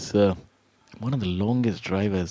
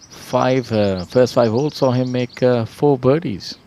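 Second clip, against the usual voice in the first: excited; 4 semitones higher, 10 dB louder, slower than usual. Real, not acted. Rising excitement over birdies.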